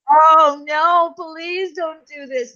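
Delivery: high, slow, whiny begging cat; pleading cat voice. Retold or imitated. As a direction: beg like a cat dreading the hat.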